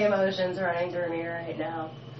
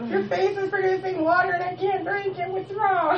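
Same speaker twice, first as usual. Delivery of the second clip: much higher, odd put-on squeaky voice; high silly voice; 12 semitones higher than usual. Cat puzzled by crying owner's face, high silly voice. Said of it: "I'm like".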